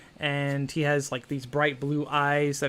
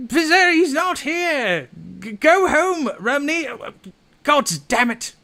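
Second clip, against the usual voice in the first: high, loud irritated disguised voice; annoyed fake voice; 12 semitones higher, 7 dB louder than usual. Pizarius tells Rumney to go home, high loud annoyed put-on voice. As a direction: shoo him away in a put-on voice. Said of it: "It's like".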